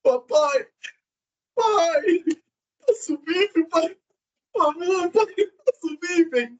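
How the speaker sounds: imitação de filho suplicando, voz bem aguda; filho choramingando. Retold or imitated. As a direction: implore ao pai num choramingo exagerado. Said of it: "Como é que seu filho vai pedir". (choramingo fingido, agudo e suplicante)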